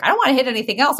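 reliving the moment she nearly lost it; frustrated outburst. Retold or imitated. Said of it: "'cause I was like".

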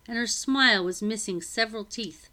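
ordinary delivery

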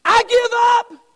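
high, loud cry of giving up; a believer crying out in surrender, high and loud; desperate cry of surrender. Retold or imitated. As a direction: cry it out like you're finally letting go.

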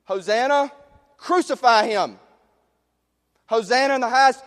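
loud, high shouted mob chant; raging crowd. Acted.

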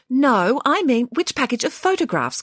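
high, perky shop elf; chirpy sales elf voice; christmas elf. A narrator's reading. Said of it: "continued the elf".

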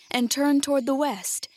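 ordinary delivery

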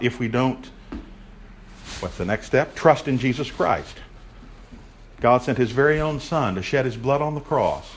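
usual speaking voice

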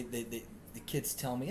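ordinary talk

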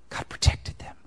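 whispered. Acted. Preacher whispering the promise back to the congregation. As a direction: whisper it, unvoiced and hushed.

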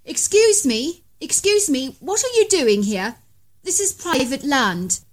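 high, loud calls to a stranger; calling out. A narrator's reading. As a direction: shout across the clearing, nervous. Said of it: "I could hear Ivan calling".

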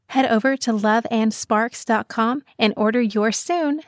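polished, scripted sponsor read. Acted. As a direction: sell it like a radio spot.

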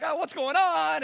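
high, loud alarmed wail; mock panic. Onlookers running from the risen man, mock panic. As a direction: cry out in acted alarm. Imitating crying, fleeing onlookers.

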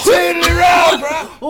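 high, loud surfer-hillbilly holler; exaggerated surfer bro shout; surfer dude. Copying the friend's sat nav voice, loud surfer dude. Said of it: "you're like a surfer dude slash hillbilly".

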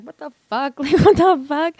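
high-pitched disbelief, breaking into a loud laugh; incredulous. Retold or imitated. Child reacting to mom's revelation about the drug dealing, incredulous. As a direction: repeat it in stunned, laughing disbelief.

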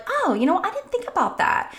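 lightly acted dawning interest; mild pleasant surprise. Parent hearing a child's cool strategy, mildly surprised.

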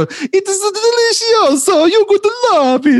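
very high, slow, cartoonish Italian chef voice; pizza-box Italian accent. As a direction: play the mustached pizza guy, way overdone. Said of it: "they go straight to the pizza guy on the pizza box".

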